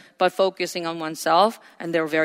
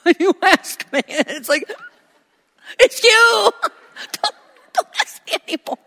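high, laughing, whining protest; mock-whiny boy who cannot take another question; exasperated whiny son; 13 semitones higher, 6 dB louder than usual. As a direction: squeal it out like a fed-up kid, cracking into laughter. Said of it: "It's just like".

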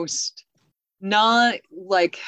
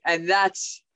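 ordinary delivery

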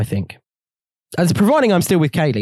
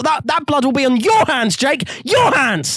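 melodramatic accusation; 8 semitones higher than usual. Pretend. Mock dramatic accusation at Jake.

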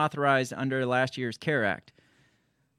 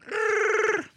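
much higher teasing squeak; mock squeaky voice. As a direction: tease in a high squeaky voice. Teasing failed rolled r.

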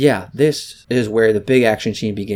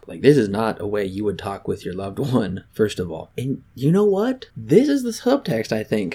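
usual speaking voice

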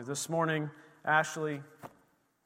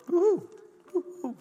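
high, loud happy cheer; excited woo-hoo; 5 dB louder than usual. Real, not acted. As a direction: whoop with joy. Cheering at the congregation.

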